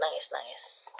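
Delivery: usual speaking voice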